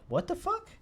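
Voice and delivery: high, mock-startled rising question. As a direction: imitate a baffled housemate, pitch shooting up. Housemates' startled reaction retold.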